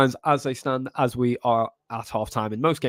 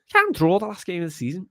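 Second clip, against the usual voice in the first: higher-pitched put-on Scouse; Jamie Carragher; 4 semitones higher than usual. Streamer mocking a season-ending draw, put-on Scouse. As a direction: do a Scouse pundit impersonation. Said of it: "It's my Jamie Carragher".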